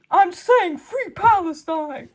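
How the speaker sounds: slow put-on character voice; slow, hammed-up character line; mock Reed voice. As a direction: drawl it out as a joke Reed. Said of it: "What are you saying, Reed?".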